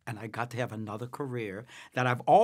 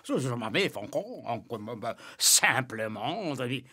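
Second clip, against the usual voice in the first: fake French. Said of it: "Sid Caesar used to do all the fake French".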